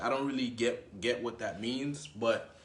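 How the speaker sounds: normal talking voice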